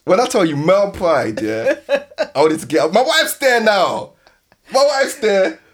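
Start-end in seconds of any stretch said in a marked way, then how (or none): 2.9-5.6 high, drawn-out complaint; indignant protest. Retold or imitated. Husband protesting that his wife saw it, indignant. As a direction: protest it higher, pride stung. Acted wounded male pride.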